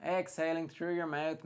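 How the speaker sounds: ordinary delivery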